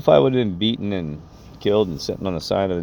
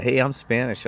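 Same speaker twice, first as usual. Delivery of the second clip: higher-pitched imitation of a man yelling; mild mock yell; 7 semitones higher than usual. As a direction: retell his shout without much volume. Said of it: "he's yelling stuff about that".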